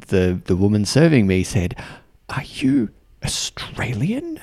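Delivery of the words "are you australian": slow, whispery, incredulous question; hushed disbelief. Retold or imitated. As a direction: half-whisper it as you imitate a stunned server. Server's question in hushed coffee shop.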